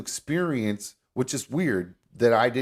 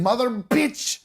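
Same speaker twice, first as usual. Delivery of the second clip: higher mock angry Indian man; mock Indian accent; 9 semitones higher, slower than usual. Shown as a demonstration. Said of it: "you just start saying".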